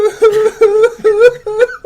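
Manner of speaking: hammed-up crying laugh; mock sob. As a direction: fake wailing sob, high and loud. Mocking the emotional speech.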